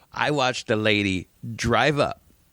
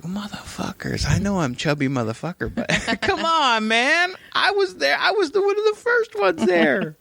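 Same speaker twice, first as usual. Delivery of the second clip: mock whiny complaint. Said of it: "I was like".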